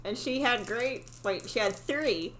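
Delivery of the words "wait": plain reading voice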